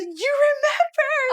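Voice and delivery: high, loud, drawn-out delighted squeal; thrilled surprise. Retold or imitated. Wife retelling her reply to a husband who remembered the anniversary, thrilled surprise. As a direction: squeal it in overjoyed disbelief. Recounts overjoyed reply to partner.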